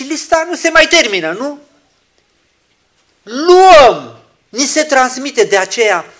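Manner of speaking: high, loud proclamation; loud preaching burst. Acted. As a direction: call it out.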